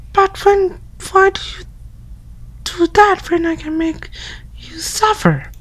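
high put-on girly voice; girl voice. Yama's friend calling through the door in a high girl voice. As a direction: pitch way up into a girl's voice. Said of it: "my girl voice".

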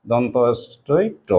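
slow sound-by-sound breakdown. Shown as a demonstration. Teacher breaks honest into sounds slowly for pupils. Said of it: "অ দিয়ে করছি".

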